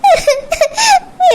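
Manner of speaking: choked sob. Acted. Wife sobbing while husband accuses.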